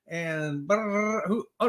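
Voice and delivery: usual speaking voice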